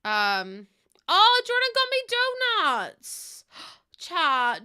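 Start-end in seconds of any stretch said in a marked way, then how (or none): 1.1-4.0 high, drawn-out squeal of joy; thrilled by a surprise gift; much higher, slow and louder, over the moon; delighted surprise. Real, not acted. Surprise gift arrives on stream.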